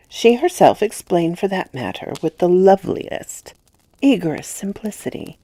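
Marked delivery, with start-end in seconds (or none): none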